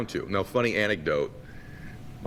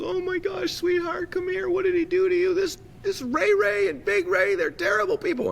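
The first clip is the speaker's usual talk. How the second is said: high, fretful mock-mother voice; distressed mom. Mom finding her beaten-up son in the kitchen, distressed high voice. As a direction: imitate an upset mom in a high pitch. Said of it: "My mom goes".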